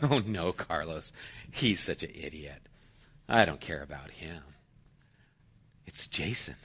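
dismissive daughter. Retold or imitated. Daughter telling parent Carlos is over, dismissive. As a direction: wave him away scornfully.

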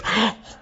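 sharp high gasp; acted sudden intake of breath; mock horrified gasp. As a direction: gasp as the shocked dog owner. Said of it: "She's standing there like this".

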